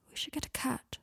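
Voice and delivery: half asleep, barely voiced; sleepily. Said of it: "said Draco sleepily".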